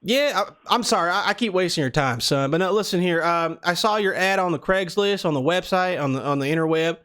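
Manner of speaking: put-on prank caller in a Southern accent. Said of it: "we're doing the Southern accent".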